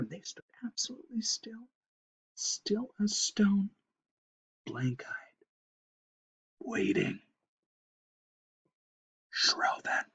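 near-whispered narration with long pauses; hushed suspense. A narrator's reading. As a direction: hold your breath between the words. Animals frozen still in fear.

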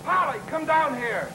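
high-pitched yell for someone upstairs; calling out. Acted. Husband calls his wife to come down, yelling. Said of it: "Stop yelling".

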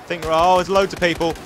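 ordinary talk

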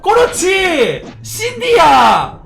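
high, loud, drawn-out shouts; excited cheering. Real, not acted. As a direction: cheer your game hero on at the top of your voice. Cheers on a game character. (신나서 길게 소리치며 응원하기)

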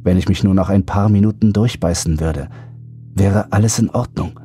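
plain reading voice

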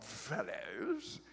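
drawn-out mock British "fellows"; soft, slow British chap send-up. Preacher dismissing the translation, mock British. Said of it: "That sounds like a British chap".

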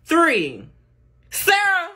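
ordinary talk

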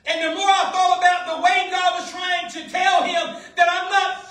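loud, driving sermon delivery; full-force preaching. Real, not acted. Preacher urging listeners that God isn't finished, full-force preaching.

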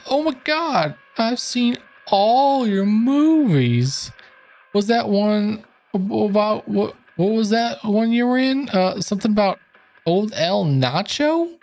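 high, slow gushing fan; starstruck fan voice. Acted. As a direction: gush over the movies. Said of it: "nice voice acting stuff here".

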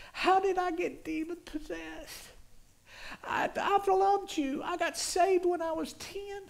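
high, anguished wail of despair; screaming to God. Retold or imitated. Man alone on the floor pleading with God, screaming in anguish. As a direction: cry out desperately, pitch climbing high. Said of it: "started screaming".

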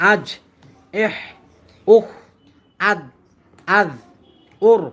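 short, clipped syllables, each said once with a pause; syllable drill. Shown as a demonstration. Teacher to students, demonstrating the pronunciation syllable by syllable. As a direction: sound out each syllable crisply for the class. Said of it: "উচ্চারণটা আমি আপনাদেরকে শোনাবো".

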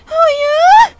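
mock delighted teacher, way up high; shrill mock "Oh yeah?" at the top of the range; imagined teacher approval. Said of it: "I thought she was more like".

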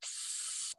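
long hissing s sound; drawn-out s like a snake. Shown as a demonstration. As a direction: hiss the S like a snake. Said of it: "makes this sound like a snake".

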